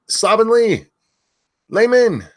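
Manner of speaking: loud high name shout; screaming names. Real, not acted. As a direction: belt the viewer's name out. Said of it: "I have to stop screaming that".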